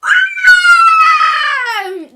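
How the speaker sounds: long high shrieking scream; baby scream. Acted. Security guard swept off his feet, a high baby scream. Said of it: "The big man screamed like a baby".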